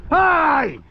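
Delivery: very high, sharp single call; high sharp hey. Real, not acted. Owner snapping his dog's attention back with a high call.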